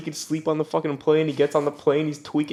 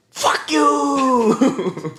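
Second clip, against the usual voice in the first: high, drawn-out mock scream; imitated scream; 11 semitones higher, 4 dB louder than usual. Imitating him when she splashes him again, screamed. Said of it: "he was screaming".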